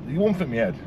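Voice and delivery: ordinary talk, nothing marked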